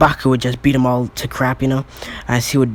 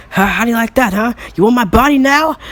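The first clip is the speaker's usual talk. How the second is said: high, loud gloating yell; cocky battle cry, rising high; taunting shout; 12 semitones higher, 6 dB louder than usual. Acted. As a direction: taunt a beaten foe at full pitch. Baku taunts villain while attacking.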